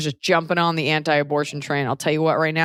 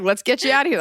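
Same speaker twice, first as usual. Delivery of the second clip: brisk mock doctor; 8 semitones higher, faster than usual. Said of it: "you could just be like".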